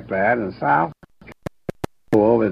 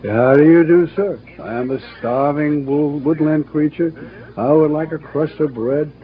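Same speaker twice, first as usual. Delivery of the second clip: higher-pitched brash bear voice; put-on voice of a smooth-talking bear; brash con bear; 6 semitones higher, 4 dB louder than usual. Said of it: "was the brash con bear".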